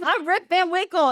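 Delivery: lightly voiced younger man announcing himself; younger Rip. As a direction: state the name brightly as the other Rip. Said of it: "original Rip is, who are you?".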